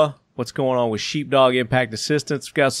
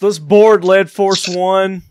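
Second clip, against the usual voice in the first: excited announcement; 7 semitones higher, 5 dB louder, slower than usual. Pretend. Host hams up boarding the plane.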